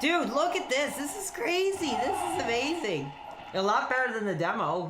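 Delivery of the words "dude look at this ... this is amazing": high, swooping excited voice; amazed excitement. Real, not acted. Streamer shows viewers the crazy gameplay, thrilled and amazed.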